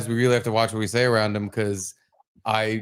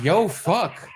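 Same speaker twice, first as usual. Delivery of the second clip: higher, amused repeat; baffled echo; 5 semitones higher than usual. Retold or imitated. Dad echoing his son's odd phrase, amused bafflement. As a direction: echo it back in amused disbelief. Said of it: "I've never said it in that tone either".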